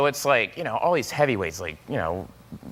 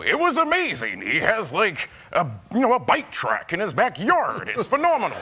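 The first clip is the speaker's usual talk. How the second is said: higher, rising Seth Rogen voice; Seth Rogen impression; 5 semitones higher than usual. Telling Tom Cruise about Seth's backyard in a higher Seth Rogen voice. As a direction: do Seth describing the bike track. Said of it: "I did a Seth Rogen impression".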